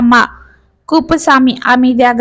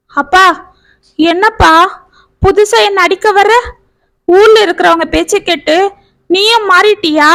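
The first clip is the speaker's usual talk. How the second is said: starting to sob; 6 semitones higher than usual. A narrator's reading. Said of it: "என்று விம்ம ஆரம்பித்தாள்".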